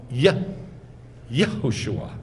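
"Yeh-, Yehoshua" opening on a short eh; stressed Yeh sound. Shown as a demonstration. Teacher asking the class why names begin this way, short-eh "Yehoshua". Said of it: "why is it always yeah".

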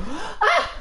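very high, sudden yelp; short high startled cry. Real, not acted. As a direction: yelp in alarm. Startled by the game.

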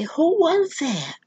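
high-pitched puzzled question; retold wondering who spoke. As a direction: ask slowly, voice way up in bewilderment. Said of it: "And I'm just puzzled".

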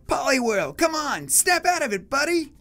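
high-pitched urgent plea; pleading young trainer. Acted. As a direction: urge your hurt partner in a high kid voice. Trainer urges his fainted Pokemon.

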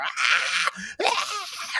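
imitating a woman's short screams; two quick mock screams. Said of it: "there's a little woman screaming".

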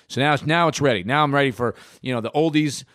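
ordinary talk

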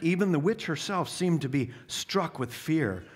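usual speaking voice

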